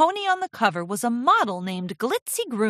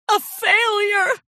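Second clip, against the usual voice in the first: high, loud, slow wail; drawn-out anguished declaration; melodramatic despair; 13 semitones higher, 6 dB louder, slower than usual. A narrator's reading. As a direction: wail it as the tragic climax. Climax of Rarity's self-pity.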